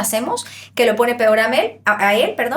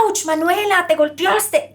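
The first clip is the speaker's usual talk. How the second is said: high, startled cry of sympathy; sympathetic ouch; 7 semitones higher, slower than usual. Retold or imitated. Audience seeing Manuela hit herself, high sympathetic ouch. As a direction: wince for her as if it hurt you. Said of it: "ustedes van a decir".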